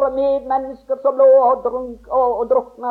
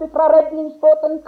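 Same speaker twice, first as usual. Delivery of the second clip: usual speaking voice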